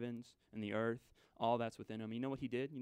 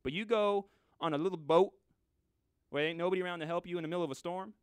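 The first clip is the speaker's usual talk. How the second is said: ordinary talk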